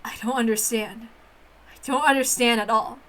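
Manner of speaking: low, haughty witch voice disdaining human games; a proud witch sneering at childish fun; scornful witch. Acted. Witch Beatrice sneering at human children's games, low and haughty.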